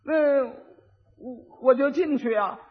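hesitate, then answer simply; simpleton husband. Acted. Simpleton answering his wife's question, dimly and hesitantly. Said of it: "傻子就过去了".

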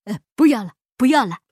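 loud, flustered protest; alarmed, urgent blurting; hasty refusal. A narrator's reading. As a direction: cut in hurriedly to stop him. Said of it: "巴依老爷忙说".

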